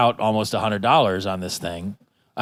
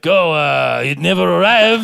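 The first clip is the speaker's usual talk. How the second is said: put-on French accent; 5 semitones higher, 6 dB louder than usual. Frenchman buyer voiced in story.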